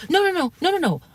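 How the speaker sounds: high, hurried mock protest; mocking his backpedal. Retelling how he backpedaled, high mocking voice. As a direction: squeak out the denials like a flustered man.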